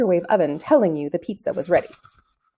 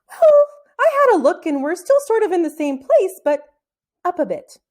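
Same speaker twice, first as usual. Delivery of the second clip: high, louder, near-tearful voice; shaken; 10 semitones higher, 5 dB louder than usual. Acted. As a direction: voice wobbling on the edge of tears. Boy shaken high in sky.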